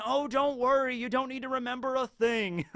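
high, sing-out villainous reassurance, acted; breezy reassurance. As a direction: brush the worry away in a high put-on voice. Baron breezily reassures Muchigoro.

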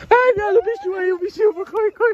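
panicked high yelps fleeing a wasp; being chased. Real, not acted. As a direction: shriek and run from the wasp. (gritando assustado, fugindo do marimbondo)

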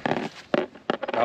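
usual speaking voice

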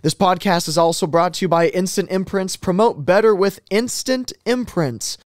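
upbeat sponsor read. Acted. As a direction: read it like a radio ad. Reads sponsor ads.

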